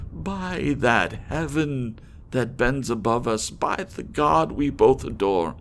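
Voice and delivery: high, loud beseeching, slowing on the maiden lines; desperate plea. Acted. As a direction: plead for one last hope. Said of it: "said I".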